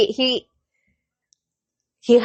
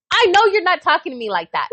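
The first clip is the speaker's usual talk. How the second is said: higher, quick indignant comeback; getting mad; 6 semitones higher, faster than usual. Retold or imitated. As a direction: retell a mother losing patience. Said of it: "I'm getting mad now".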